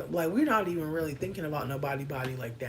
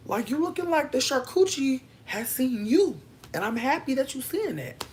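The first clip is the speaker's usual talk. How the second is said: building hype compliment; 7 semitones higher than usual. Retold or imitated. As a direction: hype up a friend more and more.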